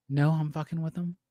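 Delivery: ordinary talk, nothing marked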